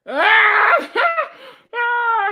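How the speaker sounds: high, loud squeal of laughter; shrieking laugh. Real, not acted. Streamer cracking up at a viewer's comment, shrieking laugh. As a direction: burst out in a real high cackle.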